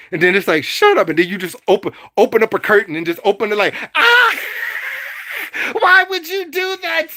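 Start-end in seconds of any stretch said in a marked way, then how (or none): 0.6-1.1 higher, louder snap; mock shut-down. Cutting off the villain. 3.9-7.1 hammed-up agony; burning witch screaming. Witch burned by the sunlight.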